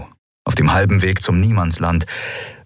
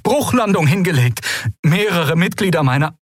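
high, urgent stranger's voice; frightened ship captain calling; desperate distress call; 11 semitones higher than usual. Acted. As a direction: plead for rescue over static.

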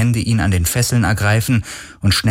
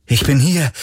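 rufend; 6 semitones higher than usual. Acted. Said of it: "rief er wieder".

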